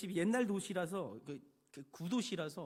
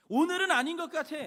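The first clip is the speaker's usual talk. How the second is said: higher, much louder, punching 오늘은; loud insistent excuse; 9 semitones higher, 11 dB louder than usual. Retold or imitated. A doubter loudly refuses to build today, insistent shout. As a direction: let the excuse burst out, insistent.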